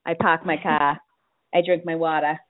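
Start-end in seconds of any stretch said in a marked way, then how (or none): none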